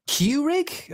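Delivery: KEURIG as a high rising question, first syllable like "cue"; questioned pronunciation. Real, not acted. Narrator doubting how to say the brand, Keurig queried high as CUE-rig. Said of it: "if I pronounce it wrong".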